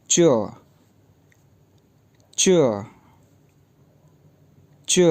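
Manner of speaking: च said with a sharp falling fourth tone, like a firm command; Chinese fourth tone. Shown as a demonstration. Teacher modelling च with a falling tone. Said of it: "fourth tone के साथ".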